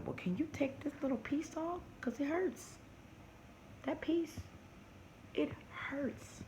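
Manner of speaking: high, breathy whispered plea; pleading whisper. Retold or imitated. Patient asking the dentist in a high whisper to remove the piece. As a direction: beg the doctor softly, it hurts. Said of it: "And then I would have asked them".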